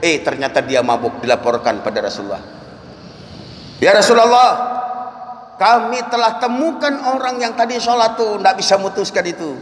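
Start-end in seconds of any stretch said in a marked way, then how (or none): 3.8-9.6 high-pitched call to a leader; urgent report. Acted. Companions report a drunk worshipper to the Prophet, urgent report.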